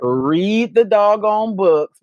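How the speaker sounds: slow, deliberate self-scolding; emphatic. Real, not acted. Wife scolds herself for not reading, slow and emphatic. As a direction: spell it out slowly as a mock order to yourself.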